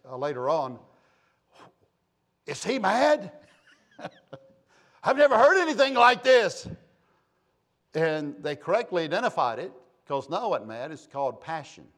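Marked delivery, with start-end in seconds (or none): 2.5-6.7 louder, higher, incredulous quote; acted-out outraged astonishment; shocked churchgoer. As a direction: imitate a startled newcomer to loud preaching. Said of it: "they asked their to-be, you know, step parents".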